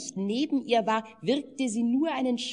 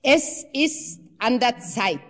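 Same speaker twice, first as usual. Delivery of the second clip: louder, booming proclamation; mighty call; 4 semitones higher, 7 dB louder than usual. A narrator's reading. The old man proclaims to the temple, booming call. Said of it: "rief der Alte mit gewaltiger Stimme".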